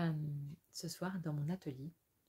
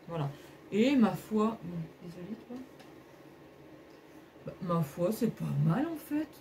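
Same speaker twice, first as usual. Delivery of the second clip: usual speaking voice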